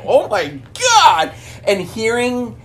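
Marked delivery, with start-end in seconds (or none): 0.0-1.3 high, drawn-out astonishment; slow, swooping mock disbelief; acted shock. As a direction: exclaim it as a stunned, delighted viewer. Acts out shocked viewer reaction.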